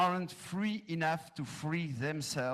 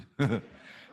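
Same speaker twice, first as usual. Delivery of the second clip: brief self-conscious laugh; 4 dB louder than usual. Real, not acted. Speaker laughs at own joke.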